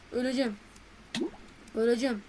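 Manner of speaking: ordinary delivery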